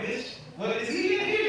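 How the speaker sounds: high startled squeal; mock alarmed. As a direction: pretend to look around in alarm. Mock panic looking around.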